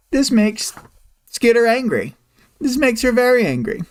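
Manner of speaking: high-pitched comic put-on voice; mock cartoon indignation. Mock catchphrase voice for Skitter's anger.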